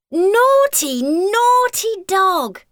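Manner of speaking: high, loud, slow naughty naughty; exasperated scold. Acted. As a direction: tell the dog off, dragging it out. Scolding the dog.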